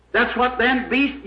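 usual speaking voice

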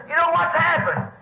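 high, eager question; retold excitement, pitched well up; excited news-bringer. Neighbour pressing his news on the preacher, excited.

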